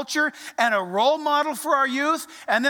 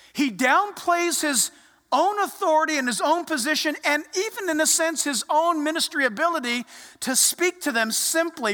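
normal talking voice

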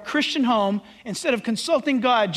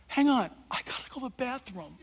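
dropped, much softer voice; sudden aside; 7 dB quieter than usual. Acted. Drinker excusing himself for the bathroom, hushed aside. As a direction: break off and excuse yourself softly.

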